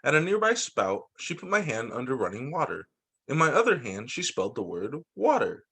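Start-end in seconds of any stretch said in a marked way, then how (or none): none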